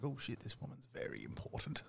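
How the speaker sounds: hushed, breathless whisper of alarm; whispered panic. Retold or imitated. Dad privately panicking at meeting the president, whispered. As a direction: whisper it to yourself like you just realised who she is.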